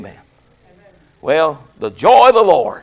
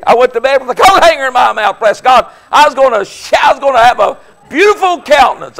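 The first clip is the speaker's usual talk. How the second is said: lively retelling; 6 semitones higher, 6 dB louder than usual. Retold or imitated. Lively comic retelling, raised voice.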